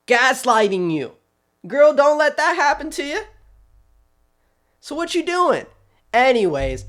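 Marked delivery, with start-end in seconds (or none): 1.7-5.6 high, loud sassy warning. Real, not acted. Commentator tells the city, girl to girl, sassy and high. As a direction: warn her like a best friend.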